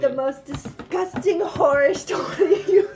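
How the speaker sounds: put-on high, slow, laughing Valley Girl drawl. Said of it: "What was that voice?".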